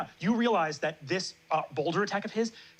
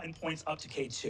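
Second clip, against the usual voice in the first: ordinary delivery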